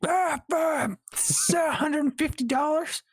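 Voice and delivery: hammed-up hysterical Bills fan; drunk fan. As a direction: shriek it like a wild fan. Voicing excited drunk fans.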